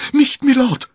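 very high, loud cry of alarm; scream. A narrator's reading. Constanze throwing herself between the men, screaming. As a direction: scream to stop the fight. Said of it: "schrie Constanze".

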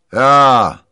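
loud, high captain's shout; big seafaring bellow; ship's captain. A narrator's reading. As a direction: answer like a captain calling from deck.

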